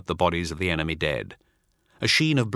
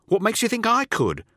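much higher, disbelieving; incredulous underling. Acted. Teth protesting to Null in disbelief. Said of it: "says Teth".

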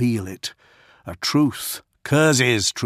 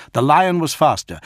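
ordinary delivery

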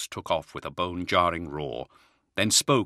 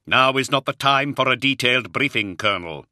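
louder, stern cut-off; sternly; 6 semitones higher, 6 dB louder than usual. Acted. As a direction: shut down the question firmly. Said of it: "Luther said sternly".

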